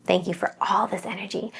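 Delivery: soft, almost to herself; hushed gratitude; quiet thanks. Shown as a demonstration. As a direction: murmur it under her breath. Thanking the energy in private.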